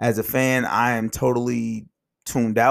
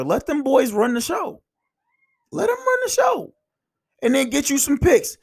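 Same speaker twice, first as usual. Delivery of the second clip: rising hyped shout; 13 semitones higher than usual. Real, not acted. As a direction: pitch climbs, then a much higher shout on the repeat. Hyped rant about young players.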